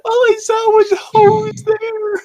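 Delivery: very high, slow squeaky voice; goofy falsetto read; squeaky silly voice. Acted. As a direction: stretch it out in a cartoon squeak. Joking misread line.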